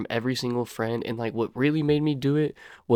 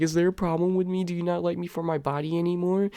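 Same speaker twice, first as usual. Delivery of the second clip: higher, pleading mock-tearful voice; imitating crying girlfriend; 7 semitones higher than usual. Boyfriend imitates his crying ex asking if he still likes her. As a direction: whine it like she's breaking down in tears. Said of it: "she starts breaking down and she starts crying".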